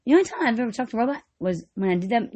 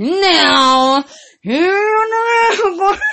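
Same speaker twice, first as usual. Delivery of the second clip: slow drawn-out; 6 semitones higher, 6 dB louder, much slower than usual. Acted. Drawn-out announcement.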